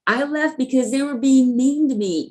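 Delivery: high mock-whiny voice; self-mocking whine over being treated mean; mocking own whiny excuse. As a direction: send up her drunk self pleading. Mocking her own drunk excuse.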